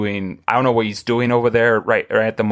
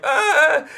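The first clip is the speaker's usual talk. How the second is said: startled yelp. Retold or imitated. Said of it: "I'm like".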